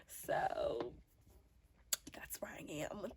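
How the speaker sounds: slow whispered aside; slow, secretive whispered aside. Real, not acted. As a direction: drop to a slow whisper, as a joke.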